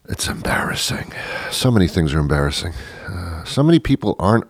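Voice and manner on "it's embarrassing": breathy aside whisper; hushed confiding whisper. Acted. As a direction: drop to a whisper for the joke. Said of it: "whisper heard".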